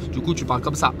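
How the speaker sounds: runny-nose voice; stuffy nose voice, nasal and blocked. Real, not acted. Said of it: "le nez qui coule tout le temps".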